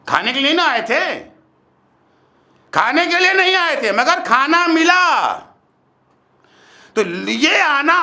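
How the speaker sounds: high, emphatic sermon voice with stretched phrases; forceful preaching. Real, not acted. Preacher separating purpose from what was received, forceful preaching. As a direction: hammer the distinction home.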